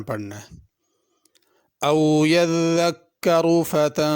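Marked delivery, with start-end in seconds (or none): 1.8-4.2 slow, drawn-out melodic tajweed chant; Quran recitation. Acted. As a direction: recite slowly with long stretched vowels.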